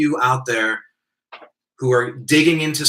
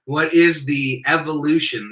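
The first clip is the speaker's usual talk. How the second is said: normal talking voice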